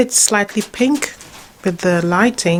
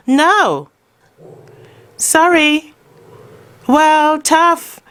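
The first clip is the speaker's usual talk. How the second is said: mock stern with dog; 7 semitones higher, much slower than usual. Real, not acted. Owner playfully refusing her grumbling dog, mock stern and high. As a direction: tease the sulking dog with no.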